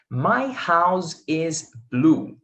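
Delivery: slow demo. Shown as a demonstration. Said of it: "Então, olha só".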